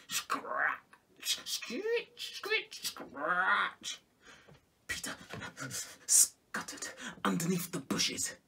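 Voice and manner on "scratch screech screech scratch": scratchy imitated hoe sounds; hoe scraping noises. A narrator's reading. Storyteller mimics the hoe scraping nearby.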